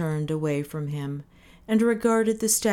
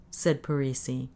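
ordinary delivery

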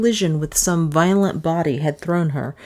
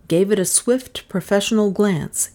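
ordinary delivery

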